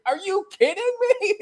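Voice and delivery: very high, disbelieving squeak; incredulous. Retold or imitated. Priest stunned by the Vatican, high disbelieving squeak. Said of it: "And he's like".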